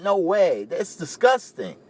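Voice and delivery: imitating his younger self, grossed out and incredulous; disgusted. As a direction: recoil in mock horror at the idea. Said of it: "I was like".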